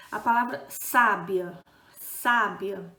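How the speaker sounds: sábia with the first syllable SA hit loud and long; sábia stressed on SA, teacher pointing out the tonic syllable to students. Shown as a demonstration. Teacher to class: sábia, loud stress on SA. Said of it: "a primeira ... a sílaba tônica é o sa".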